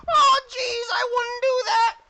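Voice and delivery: very high, loud squealing goblins; goblin mob voice. Acted. Goblins turning hostile on the player, high loud squeal. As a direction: shriek it like a panicky goblin mob. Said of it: "and they go".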